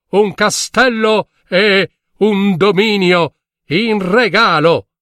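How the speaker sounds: high, ringing proclamation; town crier. A narrator's reading. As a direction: call it out across the square. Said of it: "Banditori a cavallo, a suon di tromba". (banditore che proclama ad alta voce)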